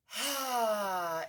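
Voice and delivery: loud, long heavy sigh. Shown as a demonstration. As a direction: let out a big releasing sigh. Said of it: "Indulge in a heavy sigh or two".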